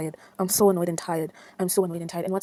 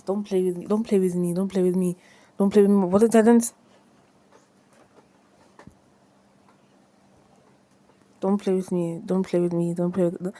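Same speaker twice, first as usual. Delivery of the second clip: louder, clipped frustration at the game; annoyed repeated complaint; 3 semitones lower, 7 dB louder, faster than usual. Real, not acted. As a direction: scold the game, fed up. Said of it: "I'm so annoyed".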